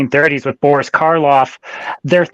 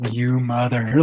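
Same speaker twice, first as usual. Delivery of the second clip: low, softer pretend cursing; cut-off pretend swear, pitched down; mock furious mutter; 5 semitones lower, 6 dB quieter, slower than usual. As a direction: start a curse under your breath, playing furious. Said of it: "I'd be so frickin' furious".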